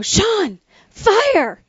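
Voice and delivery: high, urgent call of his name and fire; alarmed yell. Retold or imitated. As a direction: shout the warning up high. Wife yelling alarm to husband.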